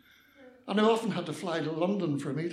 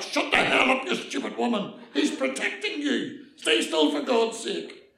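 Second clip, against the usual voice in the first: loud, raised, exasperated police rebuke; shouted back; 6 semitones higher, 5 dB louder than usual. Acted. Policeman to the struggling old lady, shouting back angrily. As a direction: bawl her out in exasperation. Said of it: "The policeman shouted back".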